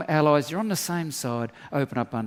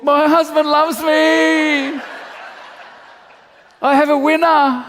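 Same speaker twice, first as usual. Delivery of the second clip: much higher and louder, very slow, laughing as she calls out; mock-boasting wife shouting her joy through laughter; gushing wife on Facebook; 12 semitones higher, 12 dB louder, slower than usual. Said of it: "She'll be on Facebook".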